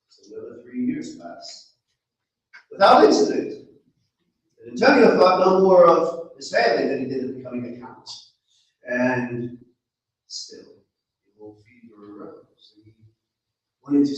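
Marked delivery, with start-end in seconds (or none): none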